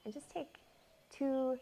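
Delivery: unhurried meditation voice with long pauses; guided meditation. Real, not acted. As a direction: gently lead them into the breath, phrase by phrase. Leads a guided breath meditation.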